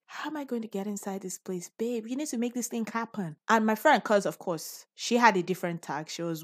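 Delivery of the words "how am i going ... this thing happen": hushed. Retold or imitated. Whispered plea to friend.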